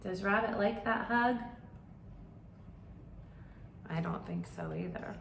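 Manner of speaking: ordinary delivery, nothing marked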